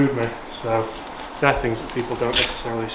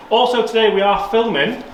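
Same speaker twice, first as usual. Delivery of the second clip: presenter voice; 7 semitones higher than usual. Acted. False start retake.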